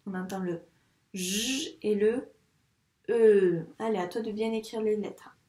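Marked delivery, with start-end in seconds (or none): 1.1-1.8 "j" as a long sustained buzz; stretched "j", the sound rather than the letter name; isolated sound. Shown as a demonstration. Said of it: "On entend quel son dans la syllabe". 3.1-3.7 "e" held loud and long; emphatic, stretched "e" as in French "je"; isolated sound. Shown as a demonstration. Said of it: "On entend quel son dans la syllabe".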